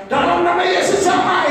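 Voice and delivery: high, loud and slow, a showy shout; shouted showy prayer. Shown as a demonstration. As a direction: belt it out to be noticed, slowly. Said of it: "nggak usah teriak-teriak". (doa teriak-teriak untuk pamer, pelan dan keras)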